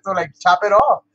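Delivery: usual speaking voice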